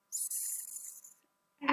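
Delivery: sad drawn out sound by sound; slow segmented sad; stretched out slowly. Shown as a demonstration. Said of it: "Let's do that again together".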